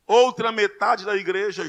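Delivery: ordinary delivery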